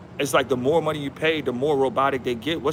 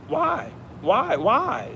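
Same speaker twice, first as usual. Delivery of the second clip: incredulous why; 11 semitones higher, much slower than usual. Real, not acted. Pressing why about hidden hands.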